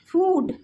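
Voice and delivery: फूड with a long oo; long vowel. Shown as a demonstration. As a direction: stretch the oo in फूड. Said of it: "long sound".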